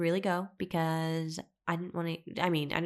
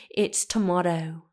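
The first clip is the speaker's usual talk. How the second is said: louder, slow British "tomato" with a long ah; UK accent; 5 dB louder, slower than usual. Retold or imitated. Boyfriend insisting on his pronunciation, amateur UK accent. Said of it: "how to do a U K accent".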